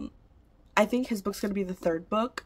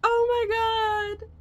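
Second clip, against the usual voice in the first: giddy laugh; 3 dB louder, slower than usual. Real, not acted. Overwhelmed unboxing.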